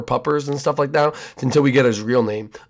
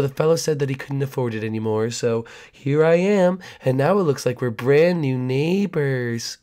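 creepy neighbor. Acted.

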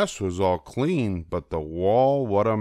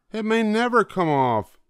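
much higher, stress on never; worried Sally; 13 semitones higher than usual. A narrator's reading. Sally fretting about mother's dress, much higher. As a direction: fret it, little girl voice. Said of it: "Sally said".

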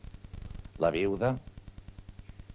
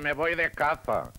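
voz de niño; 7 semitones higher, 4 dB louder, slower than usual. Acted. Niño habla a su padre.

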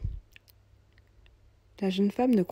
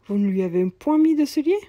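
shocked; 5 semitones higher, 9 dB louder than usual. Acted. Cobbler shocked at bare feet.

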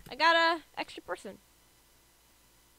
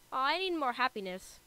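ordinary talk, nothing marked

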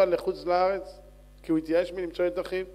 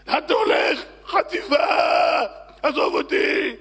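high, loud panicked shouting; frantic high shouts; alarmed protest; 7 semitones higher, 8 dB louder than usual. Acted. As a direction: yell as if being kidnapped. Passenger protests being abducted.

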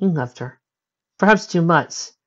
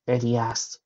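ordinary delivery

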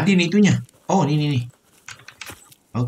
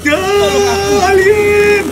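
long, very high, loud scream; drawn-out shriek at a sudden sighting; terrified scream; 6 dB louder, much slower than usual. Real, not acted. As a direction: scream the word out in panic. Alien appears in horror game.

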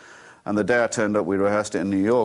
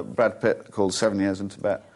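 ordinary delivery

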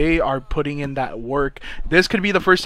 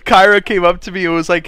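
ordinary delivery

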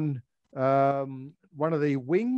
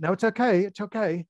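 imitating a friend's agitated reassurance, a bit higher; half-acted, insistent brush-off; retold agitated reply; 5 semitones higher than usual. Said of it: "he was getting a bit agitated".

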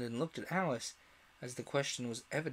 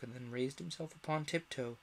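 ordinary delivery

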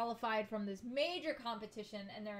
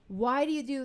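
loud, higher mock protest to parents; retold exasperated question; 4 semitones higher, 10 dB louder than usual. Vlogger retelling asking parents about dance competitions, mock exasperated.

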